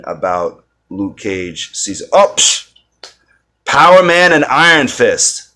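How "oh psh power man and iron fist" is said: excited. Real, not acted. Remembering a favourite comic pairing.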